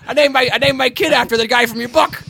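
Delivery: high, loud, pleased announcement; proud parent. Retold or imitated. Acting out a proud namer of a child, high loud pleased voice. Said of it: "they were like".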